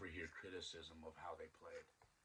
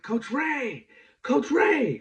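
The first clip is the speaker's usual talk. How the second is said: high, loud joyful shout of a name; excited kid shouting; calling out; 24 dB louder, much slower than usual. Retold or imitated. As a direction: yell across the lot to your coach. Kid spots coach, calls out.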